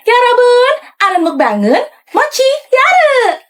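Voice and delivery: high, drawn-out spirit chant; possessed spirit voice. Pretend. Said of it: "pakai suara kodam gue".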